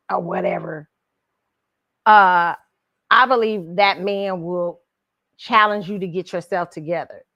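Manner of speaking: ordinary talk, nothing marked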